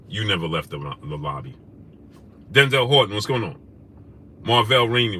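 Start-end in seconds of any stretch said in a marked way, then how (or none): 0.1-1.5 much lower and softer, a dragged-down taunt; repeating his own jab as a quiet put-down; low mocking repeat. Real, not acted. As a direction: sink the insult low and quiet. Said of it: "Oh, that's right".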